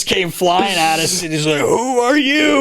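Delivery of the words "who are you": high, drawn-out mock outcry; retold startled question. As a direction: stretch it out high, imitating his alarm. Retelling startled reaction to Secret Service.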